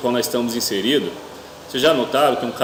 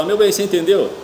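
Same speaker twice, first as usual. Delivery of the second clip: high, louder pleading husband; husband asking; 7 semitones higher, 4 dB louder than usual. Retold or imitated. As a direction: ask for attention, raised. Husband asking distracted wife.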